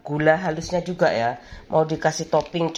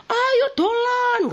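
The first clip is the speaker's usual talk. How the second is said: high, slow sing-song call; drawn-out high playground call; mock calling out; much slower than usual. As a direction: call a friend out to play. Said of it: "Kalau teriak-teriak".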